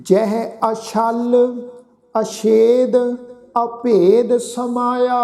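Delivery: slow, drawn-out scripture chant; slow devotional recitation of the verse. Shown as a demonstration. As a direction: recite each word slowly and reverently. Chants the Gurbani line slowly.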